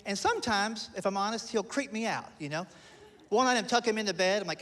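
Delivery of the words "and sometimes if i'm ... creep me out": normal talking voice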